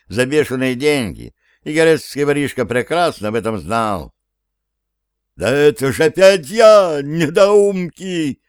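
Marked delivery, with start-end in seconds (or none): 5.4-8.4 high, loud, slow furious roar; dragon roar. Acted. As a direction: roar down at the fools. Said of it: "проревел дракон".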